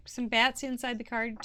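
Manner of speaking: ordinary talk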